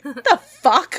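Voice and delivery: retold startled reaction. Fan startled by a stranger's yell, laughing mock shock. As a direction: act out being caught off guard.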